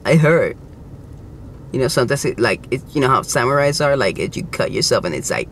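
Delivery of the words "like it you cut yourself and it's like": ordinary talk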